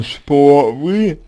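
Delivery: ordinary talk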